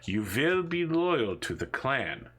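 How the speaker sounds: slow, drawn-out mock-villain command; brainwashing interrogator. Interrogator making a captive repeat a loyalty oath, slow brainwashing command. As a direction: drill it in slowly, like an indoctrinator. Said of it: "you can hear him saying".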